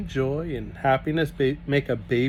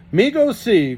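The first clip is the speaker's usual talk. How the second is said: eager Russian; 10 semitones higher, 8 dB louder than usual. Acted. Grigori eager to see baby.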